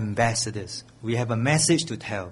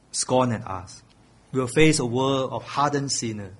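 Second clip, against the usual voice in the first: ordinary talk, nothing marked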